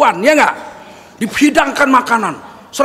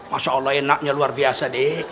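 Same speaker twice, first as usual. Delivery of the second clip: low, soft fake-admiring husband; husband's flattering lie; 11 semitones lower, 8 dB quieter than usual. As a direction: praise her cooking in a much lower, hushed voice. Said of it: "Untuk menyenangkan hati istri boleh bohong". (suami pura-pura memuji, suara rendah dan lembut)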